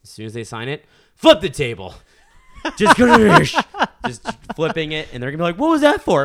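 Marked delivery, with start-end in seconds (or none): 1.2-6.2 loud, high, hammed-up shouting; mock outburst. Imagining flipping diner table, hammed-up loud yelling. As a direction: act out flipping a table loudly. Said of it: "I'm going to flip a table".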